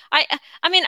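ordinary talk, nothing marked

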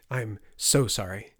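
ordinary delivery, nothing marked

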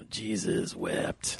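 low, soft, very slow menace; villain quote. Retold or imitated. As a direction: draw it out low and quiet. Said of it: "I don't know how".

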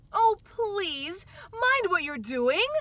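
high, loud frightened plea; terrified cry. A narrator's reading. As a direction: cry out in terror. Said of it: "cried Alice, jumping up and down in an agony of terror".

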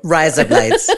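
giggly put-on Aussie; Australian accent. Co-host answering the threat of deportation, Australian accent. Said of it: "say in an Australian accent".

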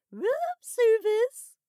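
very high, loud, drawn-out falsetto; comical falsetto. A narrator's reading. Man at the hotel door to his lover, comical falsetto. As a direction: sing it out in a silly falsetto. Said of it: "he replied in a comical falsetto".

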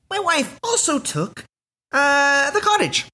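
a hammed-up comic musing about his ex-wife; divorced stand-up comic. Imagined stand-up bit about his divorce, high mock voice. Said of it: "all the stand-up is me being like".

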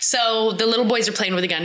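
usual speaking voice

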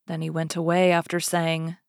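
ordinary delivery

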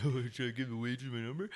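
quick, soft mimicry of a giggler; mock giggling tipper. Comic imitating a cheap tipper asking about the waitress, mock giggly. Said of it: "he's, like, giggling".

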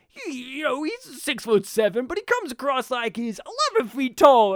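high, loud announcer drama; sports commentator. Retold or imitated. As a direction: hype it like a TV commentator. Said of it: "the one who speaks like this".

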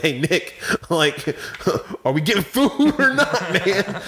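giggling through a mock plea to a friend; laughing plea. Friend retelling asking Nick for food, laughing.

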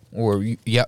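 ordinary talk

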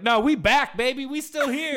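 sassy brand voice. Retold or imitated. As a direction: strut it like a cocky comeback. Toys R Us voiced.

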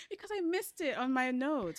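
high, laugh-filled admission. Real, not acted. Host admitting to her co-host she lost her notes, laughing. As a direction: confess through the giggles. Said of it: "Why are you laughing".

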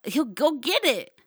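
loud, high, emphatic enthusiasm; excited yelling. Real, not acted. Host raving about marriage perks, excitedly yelling. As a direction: get carried away, punching and stretching key words. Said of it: "I'm sorry I'm yelling".

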